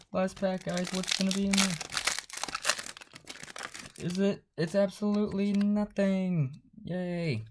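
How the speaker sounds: a fake cheer over a worthless pack; sarcastic fake excitement. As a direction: pump it up with obvious sarcasm. Sarcastic reveal of bad pack.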